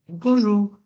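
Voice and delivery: toute petite voix aiguë et plus forte; un minuscule bonhomme qui lance bonjour d'en bas. A narrator's reading. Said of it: "une petite voix". (petite voix aiguë de monsieur Petit qui salue le facteur)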